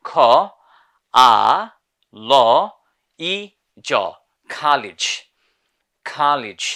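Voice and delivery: college in an American accent with an open a. Shown as a demonstration. Teacher showing the class American college, with an open a. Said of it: "But in America".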